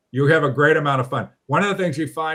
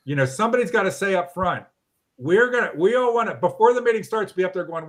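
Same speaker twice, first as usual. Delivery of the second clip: usual speaking voice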